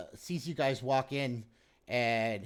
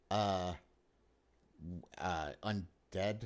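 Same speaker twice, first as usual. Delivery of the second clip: a disbelieving mayor, pitched low; lower mayor voice; 6 semitones lower, 4 dB louder than usual. Acted. Mayor doubts the undead.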